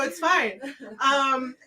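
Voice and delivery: usual speaking voice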